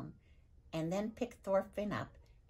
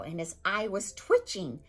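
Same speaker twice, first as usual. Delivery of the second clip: ordinary delivery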